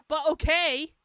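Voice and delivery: high laugh into a shrugging okay; laugh, then let it go. Real, not acted. Laughs off the haters.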